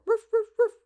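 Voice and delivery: very high, loud yappy barks; tiny dog yapping; squeaky little roof roof roof; little dogs barking. Acted. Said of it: "little dogs go".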